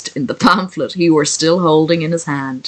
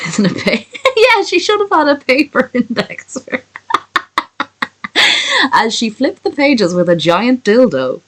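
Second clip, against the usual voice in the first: helpless giggles breaking up the words; real laughter, voice jumping higher; laughing while talking; 7 semitones higher than usual. Real, not acted. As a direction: try to get the line out through laughter. Breaks up laughing at own joke.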